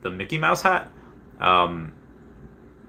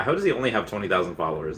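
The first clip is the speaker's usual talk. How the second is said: ordinary talk, nothing marked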